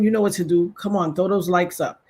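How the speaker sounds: ordinary talk, nothing marked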